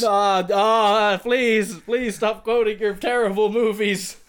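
high, loud panicked plea. Acted. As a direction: beg him to stop, flustered. Clerk pleading with shouting customer.

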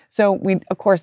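normal talking voice

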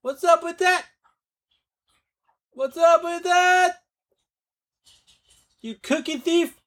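high, put-on squeaky accusation; mock outrage. Accusing girlfriend of stealing cookies, high mock whine. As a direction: whine it like a wronged kid.

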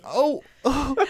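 high, small imitated woman; high-pitched put-on startled caller; taken-aback caller. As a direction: mimic her stunned little reaction. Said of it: "she was like".

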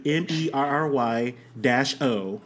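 slow, deliberate spelling, letter by letter. Shown as a demonstration. Correcting friends on the nickname's spelling, slow and deliberate. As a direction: spell "M E R R Y dash O" slowly.

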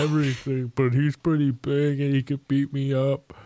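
a scared giant admits it; timid ogre. Acted. Ogre telling the party about the bully, timid.

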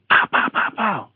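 quick popped mouth-made gunfire; imitated gunshots. Storyteller imitating a gunman firing away, popping mouth sounds. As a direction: pop out mock pistol shots. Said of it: "popping off".

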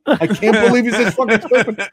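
high, squeaky-tight disbelief; incredulous mock whisper blown up. Bronzy marvelling at the duped heckler, high incredulous exclamation. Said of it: "whispering in my ear".

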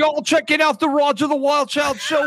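hammed-up, high-pitched bad New York accent; badly faked New York radio hype. Shown as a demonstration. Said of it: "what's your New York accent sound like?".

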